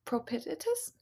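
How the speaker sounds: "propitious?" asked back with a high rising question; unsure query. Real, not acted. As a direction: doubt the word "propitious?", voice jumping up at the end.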